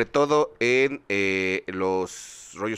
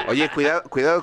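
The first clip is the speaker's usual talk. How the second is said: laughing; 3 semitones higher, 5 dB louder than usual. Real, not acted. Risa al acusar a Chris.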